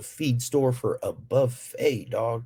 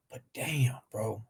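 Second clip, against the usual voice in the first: whispered damn; 8 dB quieter, slower than usual. Real, not acted. Whispered reaction.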